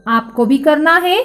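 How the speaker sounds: normal talking voice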